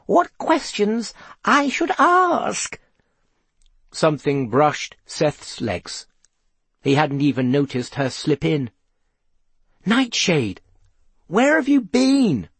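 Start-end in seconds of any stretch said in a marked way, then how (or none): 0.0-2.8 high, slow, slightly nervous voice; Gloria Troutbean. A narrator's reading. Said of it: "she sounded a little nervous". 9.9-12.5 high, drawn-out question; relieved outcry. A narrator's reading. Seth finds his missing cat.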